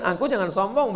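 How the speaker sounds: normal talking voice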